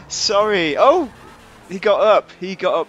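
very loud, high exclamations; amused astonishment. Real, not acted. Player watching a crash victim rise, loud astonishment.

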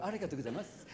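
soft, low Japanese politeness; polite Japanese. Acted. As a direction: murmur a deferential Japanese thank-you. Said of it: "you can be very polite during the day".

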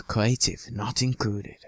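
ordinary talk

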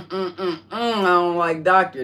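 picky refusal. Acted. Rejecting the title playfully.

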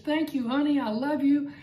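high, gleeful sweet-talk to her husband; wife thanking her husband for everything, gleeful and high; gleeful thanks. Retold or imitated. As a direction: gush thanks happily like a delighted wife. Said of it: "laughs with glee".

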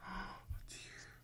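soft unvoiced oh dear; worried whisper. Real, not acted.

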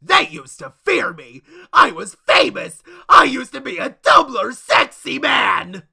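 loud, slow sobbing lament; crying wail. Acted. Mourning his faded fame, loud crying wail. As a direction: wail over lost fame.

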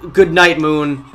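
taunting. Real, not acted. Gamer taunting his opponent after the pick. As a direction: rub it in.

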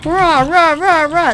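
loud mock zombie roar; zombie growl. Imitating zombie noises.

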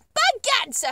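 high, loud jubilant exclamation; excited cry. Acted. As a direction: burst out in triumph. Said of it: "he cried, waving his stick".

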